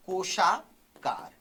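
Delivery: "कोशाकार" stretched out in two parts; slow dictation. Shown as a demonstration. Teacher dictates a term to students, "कोशाकार" slowly stretched. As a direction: draw "कोशाकार" out slowly, part by part.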